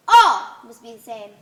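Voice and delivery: booming proclamation of the law; a stern ruler laying down the law; loud decree. Acted. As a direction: thunder the commandment.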